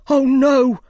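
high, loud cry of alarm; sudden shout. A narrator's reading. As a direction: cry out suddenly as the gardener spotting danger. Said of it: "Five shouted suddenly".

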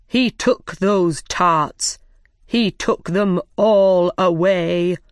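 very slow, drawn-out proclamation; formal reading out. A narrator's reading. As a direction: proclaim each phrase slowly to the room. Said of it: "read from a very long paper".

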